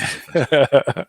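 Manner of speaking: chuckling through the words, amused at himself; laughing. Real, not acted. Co-host joking about his own tired reading, laughing. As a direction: let a laugh run under the line.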